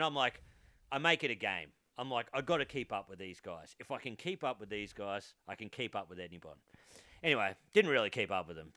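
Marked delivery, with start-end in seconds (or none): 2.3-6.6 mock self-pep talk. Private pep talk to himself.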